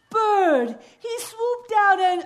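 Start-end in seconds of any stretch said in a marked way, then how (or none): 0.1-0.8 loud, high cry on bird; booming bird. A narrator's reading. Storyteller booming the bird to the children. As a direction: boom the word out to listeners.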